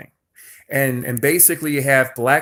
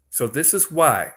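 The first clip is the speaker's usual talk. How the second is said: ordinary talk, nothing marked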